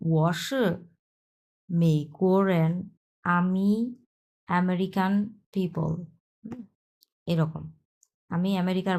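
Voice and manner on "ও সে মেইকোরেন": ও সে মেইকোরেন drawn out slowly, syllable by syllable; slow Chinese reading. Shown as a demonstration. Teacher slowly models the Mandarin for students, drawn-out reading. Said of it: "তারপরে হচ্ছে ও".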